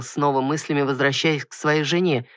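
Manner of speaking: ordinary delivery, nothing marked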